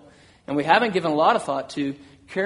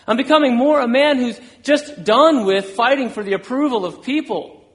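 higher, insistent preaching in repeated waves; impassioned testimony; 6 semitones higher, 4 dB louder than usual. Acted. Preacher as suffering Paul declaring his growth, impassioned. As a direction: build conviction with each declaration, pitch raised.

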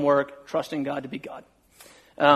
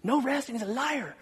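high, hushed mock accusation; imitated accusing listeners; 7 semitones higher, 8 dB quieter than usual. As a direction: mimic a scandalized congregation, softly and high. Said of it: "some of you are like".

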